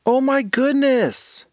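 high, slow, amazed gasp of words; high drawn-out girlish excitement; excited cry. Acted. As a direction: stretch it out in wide-eyed delight. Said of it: "cried Aaliyah".

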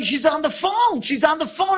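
high, excited cries from a crowd; retold yelling. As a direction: call it out high and urgent, imitating the women. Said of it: "And they're yelling".

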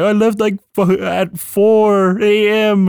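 slow hammed-up wail; mock near-crying. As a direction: imitate an upset man, dragging the words. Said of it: "he's, like, crying almost".

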